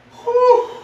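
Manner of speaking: big winded high whew, loud; exhausted relieved blow-out; high loud exhale whew. Real, not acted. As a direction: blow it out after a hard set. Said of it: "catch my breath".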